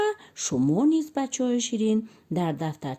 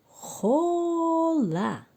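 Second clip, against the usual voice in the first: خوله stretched slow and pitched much higher; drawn-out blended word; 10 semitones higher, slower than usual. Shown as a demonstration. Teacher blending syllables for the children, slow and high. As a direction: sing out خوله slowly, rising high.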